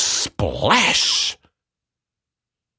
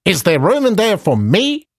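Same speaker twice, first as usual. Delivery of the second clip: high frog voice; 9 semitones higher than usual. Acted. Frog asks to join.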